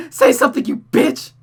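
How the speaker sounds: loud, high screamed insult; tearful scream. Acted. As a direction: scream it through tears. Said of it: "Rosa screamed with tears in her eyes".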